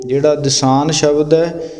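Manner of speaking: ordinary talk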